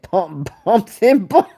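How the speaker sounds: a laugh breaking into the quote. Retold or imitated. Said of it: "Hammer said".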